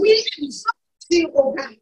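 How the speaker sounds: loud, high, full-force praise shouts; fervent praise. Real, not acted. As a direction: cry out thanks to God at full power.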